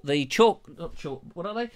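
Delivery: ordinary talk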